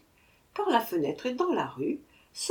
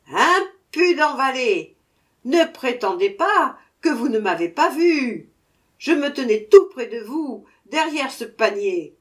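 loud, higher furious scolding; angry dwarf scolding the merchant; violent anger; 5 semitones higher, 7 dB louder than usual. A narrator's reading. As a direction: rage at the merchant. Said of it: "entra dans une violente colère".

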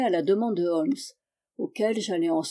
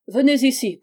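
higher, louder call; 5 semitones higher, 5 dB louder than usual. Acted. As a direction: summon him across the lawn. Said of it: "cria-t-il à un domestique".

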